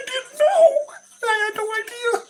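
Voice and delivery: very high, mock-crying squeal; crazy-monkey squeal. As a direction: squeal it like an overexcited little monkey. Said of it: "just like a little crazy monkey with it".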